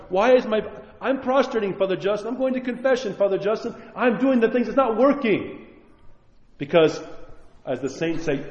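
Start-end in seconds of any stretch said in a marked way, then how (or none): 0.1-5.7 mock frustrated parishioner. Parishioner's complaint mocked.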